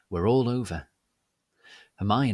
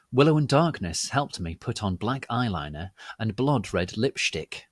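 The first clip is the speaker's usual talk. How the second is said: usual speaking voice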